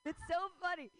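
ordinary talk

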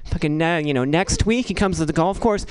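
ordinary talk, nothing marked

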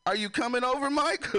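high-pitched imitation of mom; mock mother checking in; mom calling. Imitates mom on video call.

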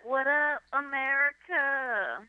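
high, slow hype-man call; mock MC shout-out. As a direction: play to the crowd like a stage host. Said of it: "I'd be like".

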